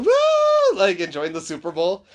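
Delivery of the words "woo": a high cheering woo. Retold or imitated. Mom cheering at the Super Bowl, high cheering woo. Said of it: "she's like".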